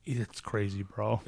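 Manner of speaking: low, soft retold remark; muted disbelief. Coworker reacting to a wild accusation, muted. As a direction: say it low, under your voice.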